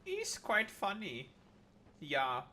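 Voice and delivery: playful mock German accent, swooping up high; camp German accent. Streamer explaining the joke to chat, camp German accent. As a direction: ham up a German accent for laughs. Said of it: "doing the homosexual German accent".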